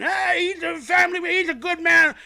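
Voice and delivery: imitating a fan yelling in her team's defence; mock yell. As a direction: stand up for him with a yell. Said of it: "she starts yelling".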